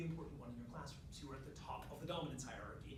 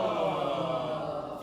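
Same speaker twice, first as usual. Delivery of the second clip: long, loud "oh" sliding down; big sustained vocal slide; full-range sigh; 2 semitones lower, 14 dB louder than usual. Shown as a demonstration. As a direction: sigh from top of range to bottom. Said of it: "full sigh from the top of your range to the bottom".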